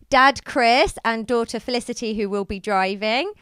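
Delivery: ordinary talk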